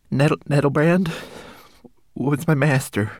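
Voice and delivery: high tearful stammer. Acted. Homunculus confessing to Ben, tearful stammer. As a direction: force the name out haltingly. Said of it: "stammered the homunculus".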